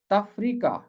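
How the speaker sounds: तफरीका said on its own as a model, falling at the end; careful pronunciation. Shown as a demonstration. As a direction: set तफरीका apart and pronounce it carefully. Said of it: "इसका तलफ़ुज़ है".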